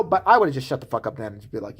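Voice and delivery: usual speaking voice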